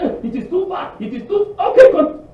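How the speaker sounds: high-pitched whiny moan; mock complaining. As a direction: whine like a tired traveller. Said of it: "But when you say".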